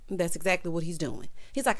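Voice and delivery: ordinary delivery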